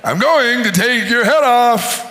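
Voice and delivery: a hint of a small boy's voice; little voice. David threatens the giant, little-voiced. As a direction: threaten lightly like a tiny kid. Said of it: "a little voice going".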